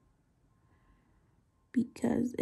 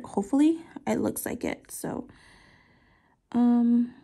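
ordinary talk, nothing marked

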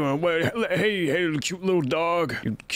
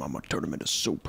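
whispered, fast, much softer threat; sinister whisper; 14 semitones lower, 7 dB quieter, much faster than usual. Acted. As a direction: whisper the plan so they can't hear. Grandpa plotting secretly.

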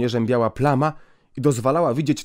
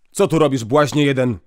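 stern call; 2 semitones higher, 6 dB louder than usual. Acted. Priest catching the hidden boy, a loud stern call. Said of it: "zawołał prefekt".

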